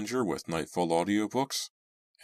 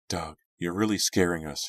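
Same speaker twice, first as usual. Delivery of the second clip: ordinary delivery, nothing marked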